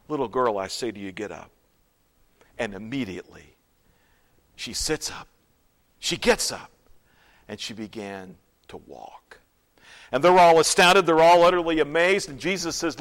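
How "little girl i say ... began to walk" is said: low voice sinking toward a whisper; quiet, low, reverent storytelling; hushed awe. Real, not acted. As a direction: tell the miracle softly, with pauses. Hushed telling of the miracle.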